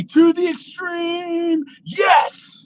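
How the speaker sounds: high, slow mock catchphrase cry; imitating co-host's catchphrase. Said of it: "I'm gonna get your voice".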